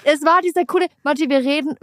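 high, put-on imitation of the partner; mocking cool voice. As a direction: mimic his smug calm reasoning. (spöttisch hohe Imitation)

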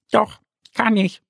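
high, bright little duck Annie. Acted. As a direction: play the small duck, pitched well up. Ente antwortet dem Bären verschmitzt. (Annie weckt Mo mit hoher Entenstimme)

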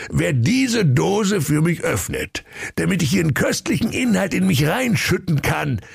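hohe, gönnerhafte Königsstimme; großspurig verkünden; verkündender König. Acted. (gönnerhafter König)

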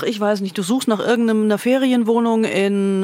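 ordinary talk

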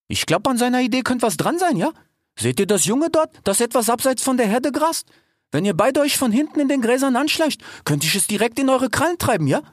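put-on high, slangy cheetah girl; cheetah Salome. A narrator's reading. Cheetah sister plans the hunt with her brothers, high and slangy. Salome lays out hunting plan.

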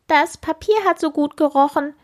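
high piping bug voice; piping beetle. Acted. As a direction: peep it like a fat little beetle. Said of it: "piepst der dicke Käfer".

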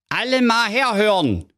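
high, booming king voice. A narrator's reading. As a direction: announce it like a pompous king. King's announcement voiced.

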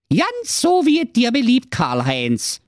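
sweetly obliging wife voice; ogre's wife. A narrator's reading. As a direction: answer him sweetly, all compliance. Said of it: "sprach die Brigitte Menschenfresser".

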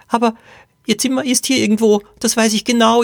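put-on high, whining little-girl voice; Poppy. A narrator's reading. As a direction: whimper it like a frightened small child. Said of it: "jammerte Poppy". (hohes, jammerndes Kleinmädchenstimmchen)

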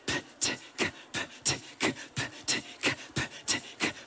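quick whispered P, T, K chain. Shown as a demonstration. Coach speeding up the warm-up, quick whispered P T K. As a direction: rattle P T K off quickly unvoiced. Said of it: "Bisschen schneller".

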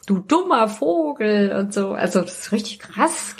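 usual speaking voice

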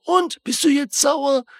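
drawn-out, high-pitched mock yell; high, slow retold shout; imitated shouting. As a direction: mimic someone yelling a goading question, stretched out. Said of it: "schreit er so".

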